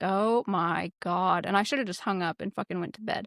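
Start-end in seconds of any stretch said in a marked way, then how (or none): 0.0-1.5 slow, drawn-out groan of disbelief; weary exasperation. Retold or imitated. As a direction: drag it out, completely fed up. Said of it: "And I was like".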